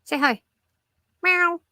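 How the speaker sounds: high sing-song voice for the cat; high cooing pet voice; pet talk. Acted. Showing her cat to viewers.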